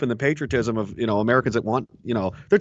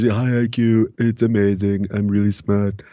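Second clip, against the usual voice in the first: lower, boastful put-on voice; mock Trump voice; 5 semitones lower, 3 dB louder than usual. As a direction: ham up a bragging president. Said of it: "somebody who's supposed to be a smart".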